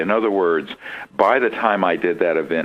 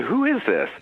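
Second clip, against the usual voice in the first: outraged demand; 13 semitones higher than usual. Retold or imitated. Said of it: "I was so outraged".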